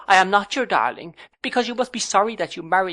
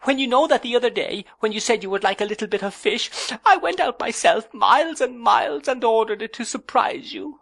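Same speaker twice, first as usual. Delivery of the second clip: high, tearful, a touch louder; sobbing; 12 semitones higher, 4 dB louder than usual. A narrator's reading. Young wife complains through tears, high and tearful. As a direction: sob out the complaint. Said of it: "sobbed Dora".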